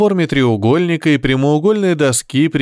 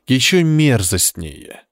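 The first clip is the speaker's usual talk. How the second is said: ordinary delivery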